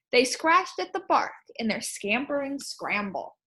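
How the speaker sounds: lively rhyming read-aloud; storybook read-aloud. Acted. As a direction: read the poem to the class.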